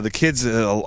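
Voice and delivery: ordinary delivery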